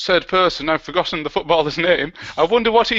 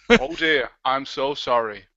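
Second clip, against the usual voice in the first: ordinary talk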